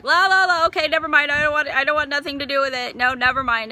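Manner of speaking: high, loud la-la drowning out a voice, easing off; acted stubborn refusal, starting high; refusing to listen. Grieving mother shutting out God's call, mock defiant babble. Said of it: "I would plug my ears and I wouldn't listen".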